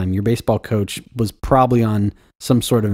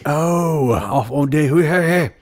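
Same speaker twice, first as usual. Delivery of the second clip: high, garbled mock British accent; hammed-up British accent; bad British accent; 5 semitones higher, slower than usual. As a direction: burble nonsense in a fake British lilt. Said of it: "my British accent should be better".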